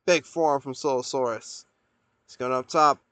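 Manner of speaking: ordinary talk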